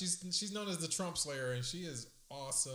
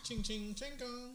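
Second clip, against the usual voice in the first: mock gong sound; 8 semitones higher, 4 dB quieter, slower than usual.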